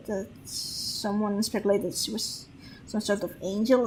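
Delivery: ordinary talk, nothing marked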